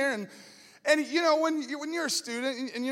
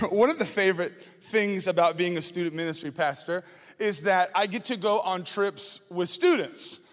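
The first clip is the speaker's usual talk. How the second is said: usual speaking voice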